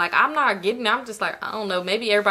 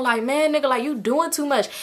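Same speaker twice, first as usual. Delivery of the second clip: ordinary delivery, nothing marked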